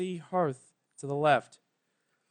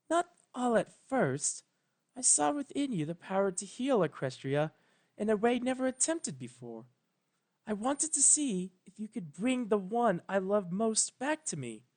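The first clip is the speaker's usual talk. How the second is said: higher, soft regal voice; higher, softer voice of a careful royal mentor; careful Celestia; 6 semitones higher, 4 dB quieter than usual. A narrator's reading. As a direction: pick each word with care, gently.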